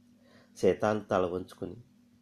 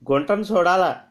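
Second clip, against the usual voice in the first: crude groom; 9 semitones higher, 6 dB louder than usual. Acted. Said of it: "అన్నాడట".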